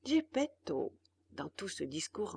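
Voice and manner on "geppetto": usual speaking voice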